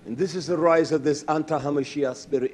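ordinary delivery

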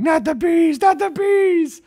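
high, slowing hammed-up yell; mock Wicker Man yell; Nick Cage yelling. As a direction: howl it in comic terror like Cage. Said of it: "I'm Nick Cage yelling".

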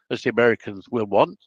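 ordinary delivery

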